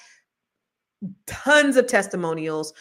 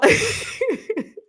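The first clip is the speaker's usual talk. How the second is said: high laugh; 3 dB louder than usual. Real, not acted. Laughs after her rant.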